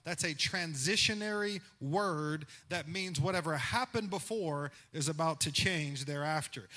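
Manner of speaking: normal talking voice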